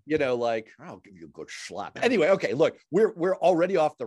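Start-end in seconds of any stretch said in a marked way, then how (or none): 0.8-2.0 Sean Connery impression. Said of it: "Sean Connery combined with".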